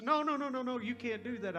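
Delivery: high, rapid mock-tearful protest; imitated weeping girl. Girl refusing help with her tires, mock weeping. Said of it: "She starts crying and weeping".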